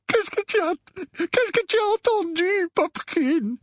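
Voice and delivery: high, anxious motherly pleading; tearful murmur. A narrator's reading. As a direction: ask your child, close to tears. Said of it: "murmura la tante Pétunia, le teint blafard et les larmes aux yeux". (mère affolée au bord des larmes)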